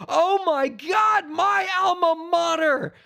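high, elated shouts of victory; jubilant gloating. Real, not acted. Sports fan celebrates his alma mater's upset win, jubilant.

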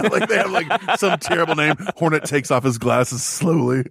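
ordinary talk, nothing marked

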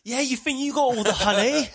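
very loud, high chav taunt; hammed-up urban yelling at a bear; urban trash talk. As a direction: shout it like a mouthy street lad. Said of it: "like, urban with him".

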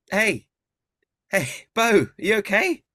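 high, loud calling from above. Acted. Friend stuck in a tree calls down to Bo, high and loud. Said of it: "you hear a voice say".

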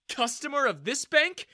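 Customer of this bank tossed back high and sneering, rising on bank; mocking echo. Daniel repeats her words, sneering. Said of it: "I'm just a regular customer of this bank".

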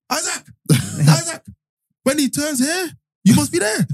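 high-pitched imitation of a touchline shout; mock coach shouting. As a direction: yelp orders like an exasperated coach, hammed up. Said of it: "And he shouts".

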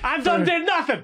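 high, laughing mock innocent plea; a high, giggly voice protesting innocence; mock suspect voice.

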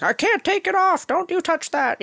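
put-on high, strained old man's warning; grumpy old man. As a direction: bark it like a cranky old caretaker. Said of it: "the old man's like".

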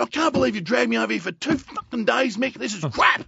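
loud, high shouted complaint; angry yell. Retold or imitated. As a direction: keep ranting at the coach. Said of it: "I said".

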